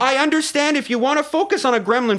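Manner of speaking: high, insistent comic exasperation; exasperated. Real, not acted. Exasperated with listener's gremlin question.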